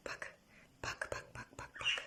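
soft hushed look look; whisper. Real, not acted. Owner hushing the quarreling birds, whispered. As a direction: hush them in a whisper.

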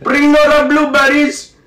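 high, loud accented cartoon king; King Julian. Cartoon king announcing the next viewer, high comic accent. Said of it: "it's meant to be King Julian".

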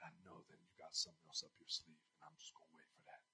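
very soft, fast, almost breathless; hushed private vow; inner voice. Retold or imitated. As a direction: say it inwardly, barely aloud. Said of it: "I just said in my spirit".

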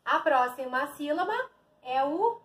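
usual speaking voice

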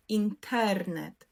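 інтернет slowly, stressed on ТЕР; наголос на передостанній склад. Shown as a demonstration. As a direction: lean on the middle syllable тер in інтернет. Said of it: "Наголос падає на передостанній склад". (інтернет повільно, з наголосом на тер)

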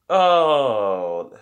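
a sudden oh as she remembers; shopkeeper's surprised oh. Acted.

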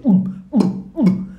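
short urgent wordless grunts; mute man's grunts. Acted. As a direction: grunt in bursts without words. Mute man's wordless reply imitated.

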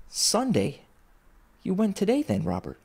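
high, soft wife's voice; gently questioning wife. A narrator's reading. Wife asks Robert about Sunday, gently surprised. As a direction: ask gently, pitch lifted then dropping.

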